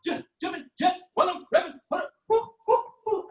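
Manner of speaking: high, panting rapper voice hyped between woos; mocking new rappers who sound like they're working out, high and puffing; winded workout rapper. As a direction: rap like you're mid jumping jacks, out of breath. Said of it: "sound like you working out all the time".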